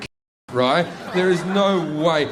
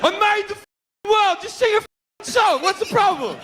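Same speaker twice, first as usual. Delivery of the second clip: furious God shout. Acted. God yells at the partygoers for not singing, furious high shout. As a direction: bellow it like an offended god. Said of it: "God yells at the partygoers".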